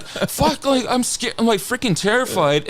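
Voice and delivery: high, mock-panicked voice; scared cursing. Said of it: "And I'm like".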